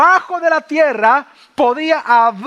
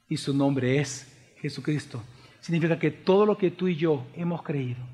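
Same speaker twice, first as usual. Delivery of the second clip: low, soft voice with weighty pauses; hushed, solemn preaching; 10 semitones lower, 7 dB quieter than usual. Real, not acted. Preacher reveals to the church who the Lamb is, solemn hush. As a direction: quietly let the revelation settle.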